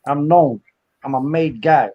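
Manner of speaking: boastful New York made man; New York wise guy. Acted. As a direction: swagger through it in a New York mobster accent. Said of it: "New York voice".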